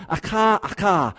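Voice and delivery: "Acha, acha" with a guttural ch like Hebrew; Hebrew doubled word. Shown as a demonstration. Preacher sounds out the Hebrew verb, careful Hebrew pronunciation. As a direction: say "Acha" twice, throaty kh in the middle, first rising then falling. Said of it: "poetic doubling".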